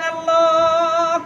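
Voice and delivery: long, drawn-out cry to God; imitated wail in a preacher's chant; retold anguished cry. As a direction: cry out Allah on one sustained note. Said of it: "চিৎকার দিয়ে বললেন".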